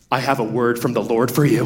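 low, solemn mock-prophetic voice; spooky prophet voice. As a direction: ham up the intense stranger with a word from God. Mocking intense prophetic people.

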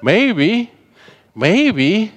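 high, lilting, tempting maybe; sing-song hopeful maybe; teasing maybe. Retold or imitated. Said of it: "the devil hearing a maybe".

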